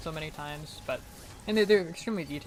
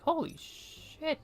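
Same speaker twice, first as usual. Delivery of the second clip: higher, slow, awed holy shit; stunned; 13 semitones higher, much slower than usual. Retold or imitated. Student learning about class accents, slow awed exclamation. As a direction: let it sink in, amazed. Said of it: "And I'm like".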